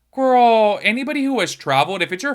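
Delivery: high, loud exasperated call-out. Real, not acted. Vlogger calling out critics, loud and exasperated. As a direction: throw it out loud, fed up.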